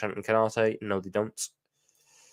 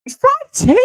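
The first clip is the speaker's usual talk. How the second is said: a sudden shrieked "five ten?", far higher and louder; shocked squeak; 13 dB louder, slower than usual. Real, not acted. Streamer learns the player's real height and squeals in shock. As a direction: squeal in disbelief. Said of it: "It doesn't work when you say five ten".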